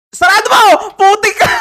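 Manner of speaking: high, loud cursing into laughter; startled yell. Real, not acted. Streamer yelling at the thing behind the door, startled shout. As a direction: shout in shock, then crack up.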